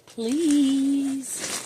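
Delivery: squeaky high please; high demo please. Shown as a demonstration. Owner modelling please for the parrot, squeaky high.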